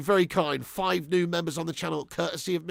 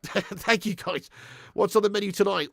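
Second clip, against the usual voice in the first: normal talking voice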